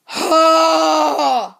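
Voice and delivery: long, drawn-out groaning sigh; weary, exasperated exhale. Acted. Fed up with being sent off.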